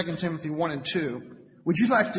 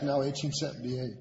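ordinary talk, nothing marked

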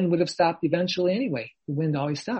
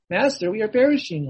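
loud, higher panicked cry; cry of despair; 6 semitones higher, 7 dB louder than usual. Shown as a demonstration. Believer cries to the Lord in despair, loud panic. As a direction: shout it like all is lost. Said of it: "cry out in despair".

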